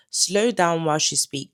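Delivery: ordinary talk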